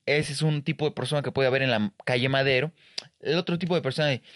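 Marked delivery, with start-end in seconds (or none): none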